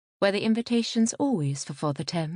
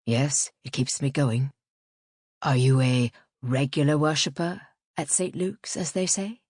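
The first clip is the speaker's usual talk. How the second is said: lightly voiced man, lower; a hint of a man's lower voice; Piers Longridge; 5 semitones lower than usual. A narrator's reading. Man asking a woman about her churchgoing, lightly lowered voice.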